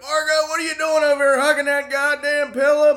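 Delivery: put-on high, loud drunken buddy call; loud mock drunk ribbing; mock drunk holler. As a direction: ham up a drunk friend bursting in. Jason's drunk question retold.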